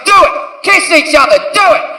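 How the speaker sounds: high pushy yell; barking at them to do it; yelling commands. Retold or imitated. Comedian pushing the girls to kiss.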